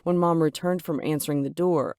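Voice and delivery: plain reading voice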